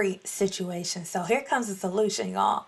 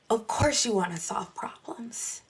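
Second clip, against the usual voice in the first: softer whispered aside; 6 dB quieter than usual. Real, not acted. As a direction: confide it under your breath. Pastor aside, dropped voice.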